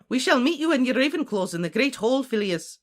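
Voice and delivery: brisk commanding professor. Acted. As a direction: issue orders as a stern professor. McGonagall gives orders in story.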